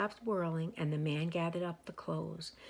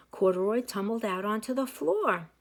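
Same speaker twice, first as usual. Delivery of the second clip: ordinary delivery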